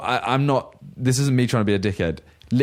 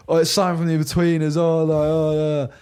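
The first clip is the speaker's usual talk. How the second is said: higher jeering imitation; mocking heckle; 4 semitones higher than usual. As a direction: mimic a lad goading someone on camera. Mimics heckler filming in his face.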